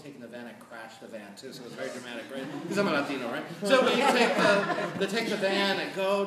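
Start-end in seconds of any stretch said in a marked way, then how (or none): 2.7-6.3 loud laughter through the words; laughing. Real, not acted. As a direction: laugh it out, much louder. Laughing at own van crash story.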